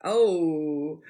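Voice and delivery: ordinary talk, nothing marked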